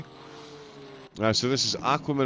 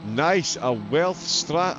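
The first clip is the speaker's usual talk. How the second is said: ordinary talk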